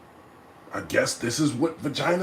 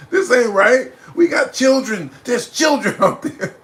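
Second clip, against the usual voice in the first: loud laughing protest, higher; 6 semitones higher, 4 dB louder than usual. Retold or imitated. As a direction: laugh through the worried protest. Retelling worry, laughing.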